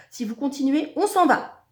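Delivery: high, loud ultimatum; offended threat. A narrator's reading. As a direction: warn him indignantly at full voice. Génies vexés menacent de partir.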